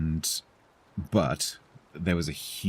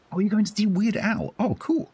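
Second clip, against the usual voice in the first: high, bright burst of delight; excited; 4 dB louder than usual. Real, not acted. Reading chat that a viewer will see [name], thrilled. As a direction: squeal it out, genuinely thrilled.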